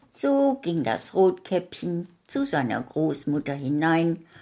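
plain reading voice

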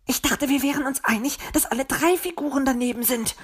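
high, huffy little bird; miffed hiss. A narrator's reading. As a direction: protest high-pitched and miffed. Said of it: "zischte Zwitschi". (hoch und pikiert zischend)

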